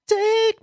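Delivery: high, loud vocal beat hit; imitated beat. Showing a friend the best freestyle beats, loud imitated beat. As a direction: punch out the song's beat with your voice.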